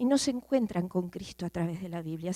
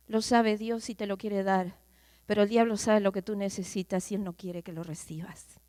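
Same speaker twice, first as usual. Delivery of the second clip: ordinary talk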